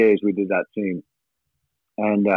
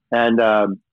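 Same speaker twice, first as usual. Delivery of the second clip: ordinary talk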